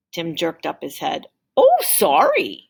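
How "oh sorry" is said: high, startled apology; surprised man. A narrator's reading. Can man bumping into Tim, startled and high. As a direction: blurt it out after bumping him.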